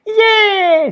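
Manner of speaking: high, loud cheer; triumphant shout. A narrator's reading. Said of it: "shouted Polly in triumph".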